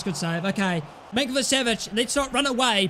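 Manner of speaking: ordinary talk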